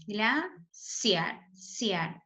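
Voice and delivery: Glaciar split in two, CIAR hit harder; teacher isolating "ciar" as the loudest syllable of Glaciar; stress on CIAR. Shown as a demonstration. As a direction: say "Glaciar" by syllables, then repeat the strong "ciar". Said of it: "es la que suena más fuerte".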